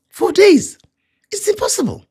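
very high-pitched mock disbelief; Martha. Preacher echoing the sister at the tomb, high-pitched disbelief. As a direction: exclaim it in a squeaky imitated protest.

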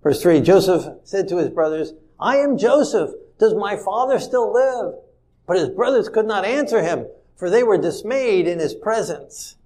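loud, declaiming scripture reading. Acted. Preacher reads the verse aloud to his congregation, declaiming.

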